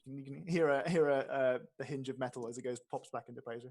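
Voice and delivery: normal talking voice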